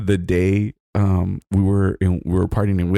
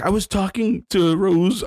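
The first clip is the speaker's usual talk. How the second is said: higher, slow, whiny excuse; mocking timid friend; 11 semitones higher, slower than usual. The friend claims he was busy talking, in a slow higher mock whine. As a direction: drag out the feeble excuse. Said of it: "he's like".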